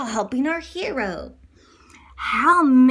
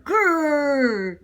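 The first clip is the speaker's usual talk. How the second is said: playful growl; bear growl; 3 dB louder than usual. Acted.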